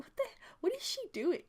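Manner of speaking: bewildered at the giggling grandma; puzzled thought. Retold or imitated. Inner confused thought.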